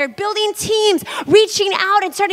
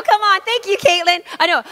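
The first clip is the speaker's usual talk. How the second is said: usual speaking voice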